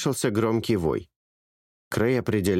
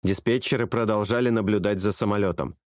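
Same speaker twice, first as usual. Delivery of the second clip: ordinary delivery, nothing marked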